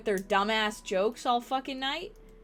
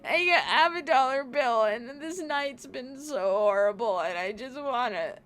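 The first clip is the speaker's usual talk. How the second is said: higher, wailing half-laughed sob; mock sobbing; 4 semitones higher than usual. Retelling crying to coworker after bad tip, mock sob. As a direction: imitate herself crying at work. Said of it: "I was crying".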